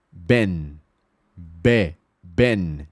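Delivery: low, isolated "ben" with open E; short open E in "ben"; short E sound. Shown as a demonstration. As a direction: break "ben" down to "be", then whole. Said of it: "vai ter som de E mesmo".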